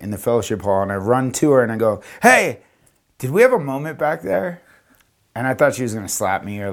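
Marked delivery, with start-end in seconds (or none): 2.2-4.6 retold eager approach; calling out. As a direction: catch someone's attention, pitch jumping up.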